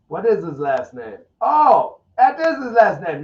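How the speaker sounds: high, loud mock-dumb "oh" of discovery; dumb realization. Streamer acts out a dumb tweet, mock-dumb surprise. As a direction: play the fool who just figured it out.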